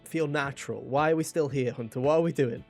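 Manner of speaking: ordinary delivery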